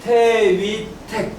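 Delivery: high, drawn-out recitation; syllable by syllable. Shown as a demonstration. Teacher drilling the next hexagram name, syllable by syllable. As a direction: hold each syllable like a class drill. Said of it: "그냥 이렇게 올라가면 되죠?".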